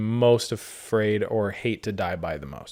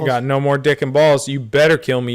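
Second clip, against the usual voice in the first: loud, high mock shout at the bear; mock yell; 4 semitones higher, 7 dB louder than usual. Victim shouting at the bear that maimed him, mock yell. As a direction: defy the bear in a hammed-up yell.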